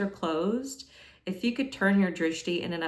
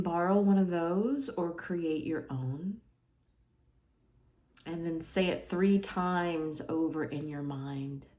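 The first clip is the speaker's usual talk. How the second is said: hushed yoga nidra guidance, fading softer; guided meditation; 5 dB quieter than usual. Acted. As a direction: guide them into relaxation, growing quieter with long pauses. Guides a body relaxation.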